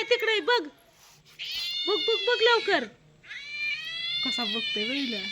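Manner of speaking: high, coaxing calls to a hiding cat; mother calling the family cat, high and coaxing; high cooing pet talk. Real, not acted. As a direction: coax the cat in a high sing-song.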